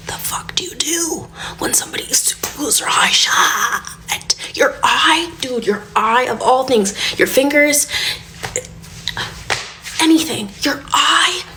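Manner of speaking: half-whispered alarm that cracks upward; panicked. Retold or imitated. Mom reliving panic over her toddler's glued eye, frantic.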